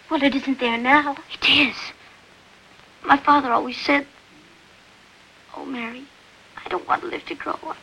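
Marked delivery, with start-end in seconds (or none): 5.5-7.8 much softer, whispered quote; boy confiding his father's words in a whisper; hushed whisper. Retold or imitated. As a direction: murmur father's words under breath. Quoting father, barely audible.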